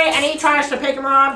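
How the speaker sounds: normal talking voice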